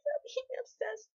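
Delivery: high, pleading, near tears; desperate pleading. Retold or imitated. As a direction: beg them in a high, crying voice. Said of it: "they're just like".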